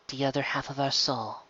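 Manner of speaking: soft, musing murmur; muttered to herself. Acted. Minbari woman musing alone over prophecies, soft mutter. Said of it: "the Minbari woman muttered to herself".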